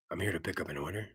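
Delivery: low, whispered tough-guy rasp; low whispered Eastwood impression; gravel tough-guy voice. Shown as a demonstration. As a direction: growl it like a movie hard man. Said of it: "talk like this".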